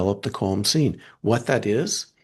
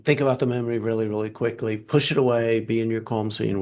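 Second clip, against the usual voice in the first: rapid task switching; faster than usual. Shown as a demonstration. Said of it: "we're doing really rapid task switching".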